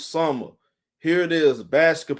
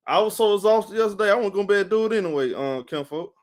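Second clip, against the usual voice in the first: normal talking voice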